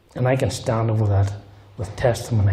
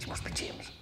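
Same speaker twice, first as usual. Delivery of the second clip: breathless unvoiced whisper; 18 dB quieter than usual. Nervous ex-detective whispers to James at the meeting, unvoiced. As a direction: hush it so only James hears.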